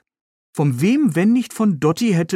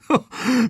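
quick amused chuckle; a brief falling laugh at the mess; short laugh; 8 semitones higher than usual. Acted. Rescuer finds the woman in the ditch, laughing briefly.

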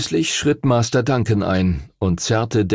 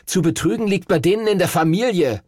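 high, quick, indignant grumble; scolding; 8 semitones higher, faster than usual. A narrator's reading. Henry about the rival's family, scolding. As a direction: rail against the cheats. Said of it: "schimpfte Henry".

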